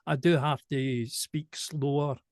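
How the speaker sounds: slow, careful, precise speech; deliberately slowed diction for clarity. Real, not acted. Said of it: "I have a really thick Glaswegian accent".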